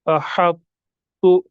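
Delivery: "अहत्तु" very slow, closed heavy t then released "tu"; stretched "अहत्तु" with a pause on the heavy t; both letters sounded. Shown as a demonstration. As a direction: recite "अहत्तु" in two parts, "ahaṭ ... tu". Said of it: "हम पढ़ेंगे इस तरह".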